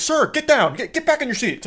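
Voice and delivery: mock flight attendant screeching at a passenger to sit; frantic flight attendant. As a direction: shriek the order at a passenger. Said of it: "She's like".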